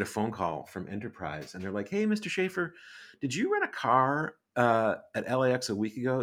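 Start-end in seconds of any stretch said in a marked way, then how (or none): 1.9-6.2 rental agent voice. Retold or imitated. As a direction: put on a chirpy phone-agent voice. Rental company caller voiced.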